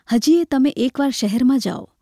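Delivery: usual speaking voice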